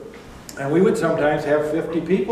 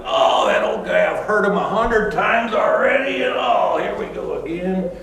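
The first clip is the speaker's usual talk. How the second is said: high, exasperated mock complaint; hammed-up bored congregant; eye-rolling listener; 6 semitones higher than usual. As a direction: groan about the old guy. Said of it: "roll your eyes and go like".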